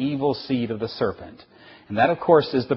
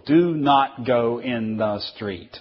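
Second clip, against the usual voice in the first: slow, word-by-word command; very deliberate parental order; absolute rule; much slower than usual. Acted. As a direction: spell out the rule, weighting every word. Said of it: "the utter rule".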